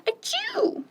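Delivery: sneeze. Acted. Duck sneezes in story.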